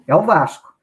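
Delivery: normal talking voice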